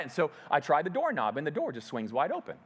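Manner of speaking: ordinary talk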